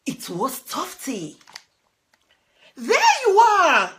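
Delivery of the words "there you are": high loud, laughing relief; relieved cry. Acted. Sparrow finding her friend, high happy cry. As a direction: burst with joy at finding him. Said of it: "Sparrow cried".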